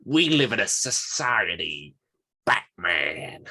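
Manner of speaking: over-the-top movie impression, slow and emphatic; Jared Leto. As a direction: draw it out theatrically, pushed and drawn-out. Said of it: "That's my other Jared Leto impression".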